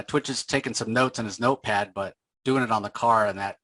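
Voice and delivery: ordinary delivery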